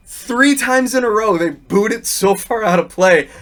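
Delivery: high, loud amused telling; delighted excited retelling; gleeful excitement. Real, not acted. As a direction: relish the story, laughing. Laughing at kids' time-wasting.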